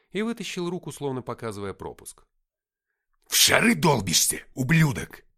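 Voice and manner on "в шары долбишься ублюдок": loud snarling bark, higher; harsh barking commands. A narrator's reading. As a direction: bark the insult at him. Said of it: "рявкнул он на охранника".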